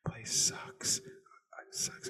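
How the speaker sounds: a hushed, breathy verdict under the breath; whispered aside. Real, not acted. To listeners after praising West Virginia, a sudden whisper. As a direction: drop to a whisper to let the truth slip out.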